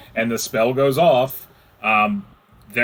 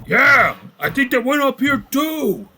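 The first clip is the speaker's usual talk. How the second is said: high-pitched orc shout; 12 semitones higher than usual. Acted. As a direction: holler back as a second orc. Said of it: "you can start hearing them".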